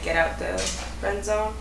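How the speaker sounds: normal talking voice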